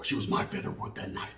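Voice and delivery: soft, unvoiced aside; whispered punchline. Real, not acted.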